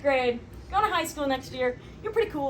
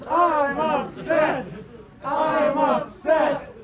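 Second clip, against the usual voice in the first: slow, louder drawn-out lament; hammed-up group mourning; mock upset; 5 dB louder, much slower than usual. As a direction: wail it like a crowd chant of grief. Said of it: "keep acting like you're upset".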